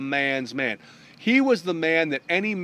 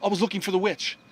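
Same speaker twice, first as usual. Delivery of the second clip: flustered, stammering apology; caught off guard; 3 semitones higher, faster than usual. Acted. Lancelot finding a beautiful stranger in bed, flustered. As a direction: stumble over the words in mild confusion. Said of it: "he's caught off guard".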